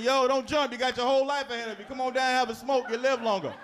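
high, coaxing plea to a jumper; mock rescuer. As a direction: talk him down with a smoke. Talking someone off a roof.

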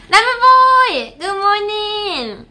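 loud, high cheerful hello; a high, loud hello to a chat member; excited greeting. Real, not acted.

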